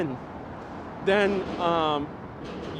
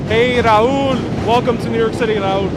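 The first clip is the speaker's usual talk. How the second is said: loud, raised greeting over noise; calling out; 4 semitones higher, 9 dB louder than usual. Real, not acted. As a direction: hail someone across a noisy street.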